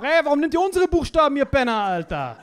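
mock outraged Greeks, high and loud; angry Greeks. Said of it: "Die dachten sich auch". (empörte Griechen, gespielt)

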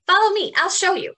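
high, loud, fast and eager; an eager bug leading the way; excited ladybug. Acted. Ladybug leads them.

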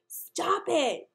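high, loud frustrated shout. Acted. Jimmy fed up with the parrot, shouting. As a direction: yell it to shut the parrot up. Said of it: "Jimmy shouted".

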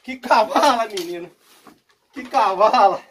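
loud, thrilled exclamations; excited shout. Real, not acted. Marveling at a huge fish to his viewers, loud. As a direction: yell with delight at the catch.

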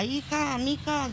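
calling her daughter from the next room, half-reenacted; retold call to daughter. Mother calling out to her daughter about the statue, mild retold call. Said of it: "le grito a ella".

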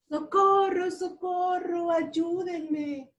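high mock cry for help. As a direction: call out for rescue, high. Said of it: "gritó el camioncito azul". (grito de auxilio agudo)

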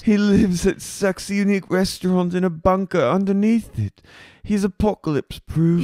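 high, insistent mock boasting; put-on boss. Boss boasting about bartender's bunker, high mock wonder.

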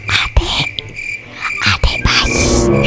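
high whispered punchline; breathy whispered reveal. Real, not acted. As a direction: whisper it slowly as a secret. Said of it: "Pasti dia minta".

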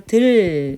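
들 held long on the ㄹ; demonstrated syllable. Shown as a demonstration. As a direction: stretch 들 out slowly. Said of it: "니은첨가 됩니다".

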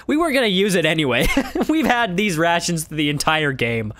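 chuckling through the words; laughing. Real, not acted. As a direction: laugh while explaining. Laughing aside.

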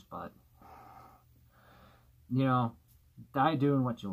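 muffled behind mask; breathing through filter; through a gas mask. Real, not acted. Seen 2: Full gas mask worn over face throughout labelled words; removed later.

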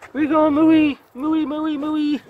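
squeaky high repeated Mooey, Mooey. Real, not acted. Calling after the pig.

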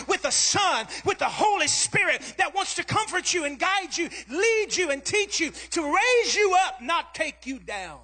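high, driving sermon crescendo; impassioned, unbroken preacher's cadence; full-force preaching. Real, not acted.

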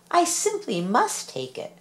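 he can't resist the kitten; eager cry. A narrator's reading. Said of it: "cried the very old man".

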